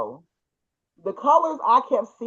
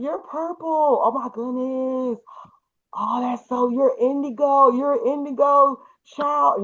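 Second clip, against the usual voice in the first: excited amazement; 5 semitones higher than usual. Retold or imitated. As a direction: re-enact being thrilled by what you see. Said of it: "And I was so excited".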